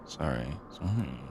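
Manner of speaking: soft, low sorry; sheepish apology. Real, not acted. Host told to stop yelling, soft sheepish apology. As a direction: drop to a small, quiet apology.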